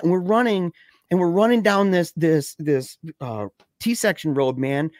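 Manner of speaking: ordinary delivery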